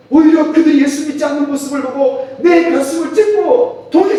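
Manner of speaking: high, loud, driving sermon; fervent preaching. Acted. Preacher rebuking judgment of unbelievers, loud and fervent. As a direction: hammer it home from the pulpit.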